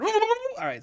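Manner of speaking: very high whooping outburst; overexcited. Pretend. Host bursting out mid-apology, overexcited. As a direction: let a silly squeal burst out. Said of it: "I got too excited".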